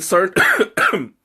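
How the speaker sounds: choking on a sip, coughing; spluttering water. Real, not acted. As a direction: sputter after it goes down the wrong pipe. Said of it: "went down the wrong pipe".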